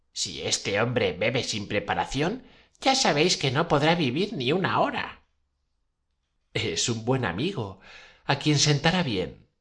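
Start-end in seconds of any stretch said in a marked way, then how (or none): none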